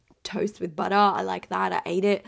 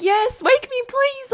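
high, loud, thrilled plea; giddy high-pitched begging; excited squeal; 10 dB louder, slower than usual. Retold or imitated. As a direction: squeal it like you can't wait to be woken. Excited waking-up quote.

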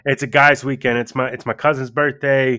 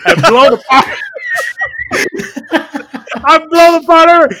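high, loud laughing retell; cracking up at the memory; shouted through laughter, much higher; 12 semitones higher, 6 dB louder than usual. Real, not acted.

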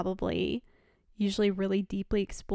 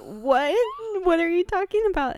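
high, loud, fast bewildered question; alarmed confusion; 9 semitones higher, 7 dB louder than usual. Retold or imitated. Retelling her teenage self, confused, higher and louder. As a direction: demand to know what he means.

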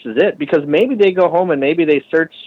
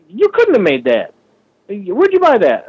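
mock disbelief; 7 semitones higher than usual. Quoting doubters of his bike.

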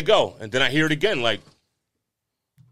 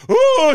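high, loud imitated groan; imitated wail; 8 dB louder than usual. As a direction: mimic the sudden cry, high and loud. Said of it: "And I hear".

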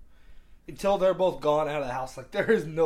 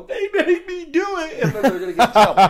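hammed-up little-kid protest, stretched out; tattling child; 14 semitones higher, 13 dB louder than usual. Youngest child immediately blames older siblings to escape trouble, hammed-up wail.

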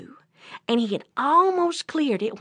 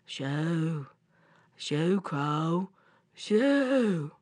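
trapped bear; 5 semitones lower, much slower than usual. Acted. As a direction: shoo feebly in a slow bear voice. Trapped bear feebly shoos crows.

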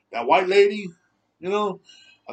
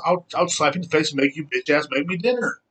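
usual speaking voice

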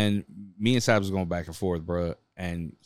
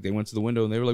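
ordinary talk, nothing marked

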